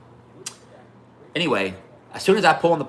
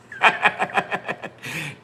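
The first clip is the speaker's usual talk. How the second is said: high, breathless real laugh; 6 semitones higher than usual. Real, not acted. Storyteller cracking up after the binky jab, real laughter. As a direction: crack up at your own punchline.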